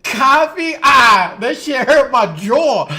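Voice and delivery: high, loud mock New Yorker; hammed-up New York "jaw"; imitating co-host's New York accent. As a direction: shout it as a teasing New York impression. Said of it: "She said".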